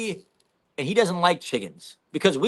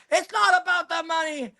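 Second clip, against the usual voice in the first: high, strained mock yell; angry high yell; 13 semitones higher than usual. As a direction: squeal it like an outraged drunk. Crackhead yelling at them outside.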